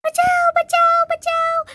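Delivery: shrill high terrified cries; screaming for help. Acted. Girls snatched by witch, shrill screams for help. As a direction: scream it like a kid in danger.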